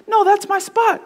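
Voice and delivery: high-pitched mock panic; starting to panic. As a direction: imitate a cousin squealing over his lost spot. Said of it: "like, panic. He's panicking".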